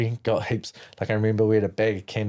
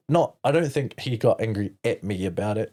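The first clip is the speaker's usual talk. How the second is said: ordinary talk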